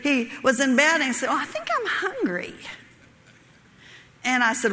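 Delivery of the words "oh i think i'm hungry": high, softer imitation of husband; mock plaintive voice, falling at the end. Wife imitates husband in bed wanting food, plaintive.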